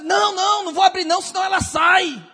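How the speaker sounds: high, loud, panicked yelling; frantic shout. Retold or imitated. Neighbor yells to the pastor through the locked door, high and loud.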